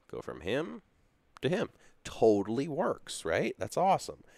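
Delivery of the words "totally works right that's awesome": excited. Real, not acted. Delighted it finally works.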